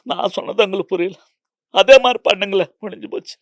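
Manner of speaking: loud, insistent Tamil director instructing an actor; Kamal Haasan in Tamil. Retold or imitated. Director mimics Kamal Haasan coaching a weak actor, loud and insistent. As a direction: coax the actor to copy you, with force. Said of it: "మీరు బాగా imitate చేస్తున్నారు".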